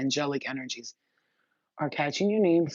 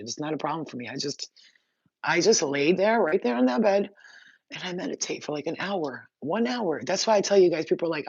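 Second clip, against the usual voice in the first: ordinary talk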